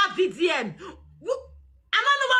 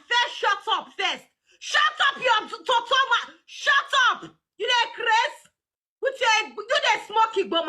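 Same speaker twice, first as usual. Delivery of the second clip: furious, higher-pitched yell of shut up, stumbling over words; angry shouting; 3 semitones higher than usual. Real, not acted. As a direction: cut her off, shouting her down. Yelling at rival madam to shut up.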